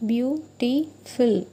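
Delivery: "beautiful" said slowly, syllable by syllable: beau-ti-ful; syllables spelled out. Shown as a demonstration. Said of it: "three syllables, that are".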